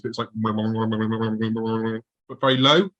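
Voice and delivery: slow, drawn-out low drone sound; a slow low droning imitation of an eerie noise; imitated low noise. Shown as a demonstration. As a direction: mimic a strange low hum slowly. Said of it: "I'll try and replicate it because it's a really peculiar noise".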